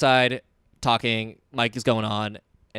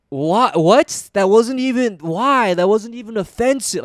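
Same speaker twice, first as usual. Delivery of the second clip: high, loud indignant protest; loud, incredulous retort, imitated; drunk and pissed; 8 semitones higher, 6 dB louder, slower than usual. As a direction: mimic an affronted drunk, pitched up. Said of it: "gets kinda pissed and was like".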